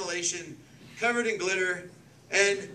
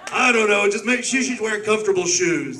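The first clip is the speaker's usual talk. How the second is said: cult leader voice; 3 semitones higher, 8 dB louder than usual. Pretend. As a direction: intone like a hammy high priest. Mock satanic ritual leader.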